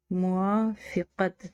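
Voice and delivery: slow, syllable by syllable; slow careful spelling. Shown as a demonstration. Teacher slowly sounding a word for students. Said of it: "मीम वाव अलीफ वा".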